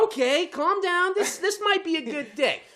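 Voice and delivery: high, drawn-out relieved self-talk; hopeful self-soothing. Retold or imitated. Driver telling himself the day may improve, high hopeful relief. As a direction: reassure yourself in a high, giddy voice. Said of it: "So I'm like".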